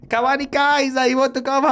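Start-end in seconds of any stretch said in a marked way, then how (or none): 0.1-0.8 high-pitched mock Thai accent; bad Thai accent. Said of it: "I can't do a Thai accent". 1.1-1.7 high-pitched mock Thai accent; bad Thai accent. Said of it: "I can't do a Thai accent".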